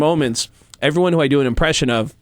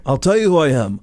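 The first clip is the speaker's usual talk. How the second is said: [name] impression. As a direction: needle him like Gene. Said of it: "Like, you know".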